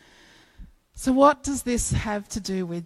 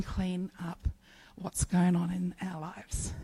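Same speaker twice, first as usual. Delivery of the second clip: whisper; 3 semitones lower, 8 dB quieter than usual. Real, not acted. Preacher drops to whisper.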